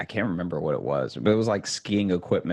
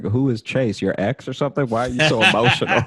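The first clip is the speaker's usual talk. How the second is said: laughing mock date; 6 semitones higher, 7 dB louder, faster than usual.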